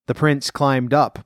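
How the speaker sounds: ordinary delivery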